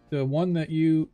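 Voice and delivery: ordinary talk, nothing marked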